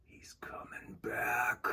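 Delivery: slow, frightened whisper; scared whisper. Acted. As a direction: breathe it out in fear.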